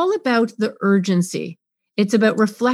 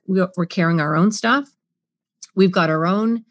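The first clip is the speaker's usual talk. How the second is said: ordinary talk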